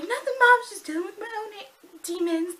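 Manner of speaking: high, loud mock cover-up; imitated teen answering a parent; daughter calling back. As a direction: call back to mom, pitch easing down. Imitated daughter calls back to mom.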